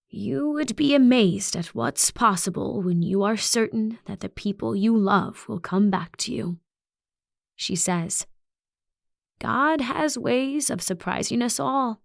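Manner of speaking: calm certainty that chills; serene uncanny principal. Acted. Principal answering a question about ghosts, serenely certain.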